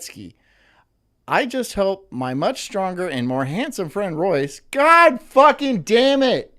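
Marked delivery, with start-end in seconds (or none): 4.7-6.5 loud, slow, high, furious swearing; frustrated yell. Real, not acted. Streamer blowing up at his own mistake, a furious slow yell. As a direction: bellow it out after botching the line again. Flubbing the read again.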